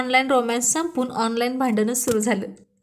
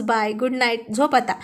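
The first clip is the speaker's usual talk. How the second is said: ordinary delivery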